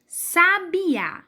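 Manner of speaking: Sabiá drawn out, leaning on the final á; Sabiá with a long stressed á at the end; stress on the last syllable. Shown as a demonstration. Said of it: "como a gente fala sabiá".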